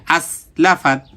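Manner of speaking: "আস, লা, ফাত" run quickly together; all read quickly. Shown as a demonstration. Teacher shows that every syllable is short, "আস, লা, ফাত" read quickly. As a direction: say "আস, লা, ফাত" promptly, without lingering. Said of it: "সবগুলো তাড়াতাড়ি".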